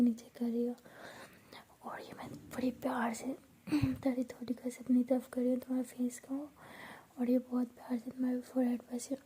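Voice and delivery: hushed, intimate whisper; soft whisper. Acted. Bhavna teasing Piyu with a pretend romance, soft whisper. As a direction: breathe it close like a secret.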